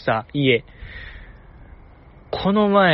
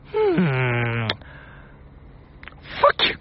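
dismissive retort; 2 semitones lower, 6 dB quieter, much slower than usual. Retold or imitated. Sleepy member grumbling back at the waker, soft mock scorn. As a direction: mutter a sulky imitated dismissal. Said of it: "みたいな言ってたんちゃうかな".

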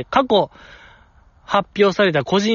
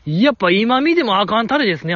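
usual speaking voice

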